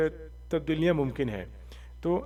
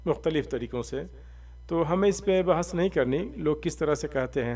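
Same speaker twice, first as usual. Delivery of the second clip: usual speaking voice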